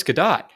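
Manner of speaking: Gadot with the T heard, "guh-DOT"; sounded final T. Shown as a demonstration. Correcting a friend on the actress's name, Gadot with T sounded. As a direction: correct it firmly to Gadot, ending on a T. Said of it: "T at the end of Gadot".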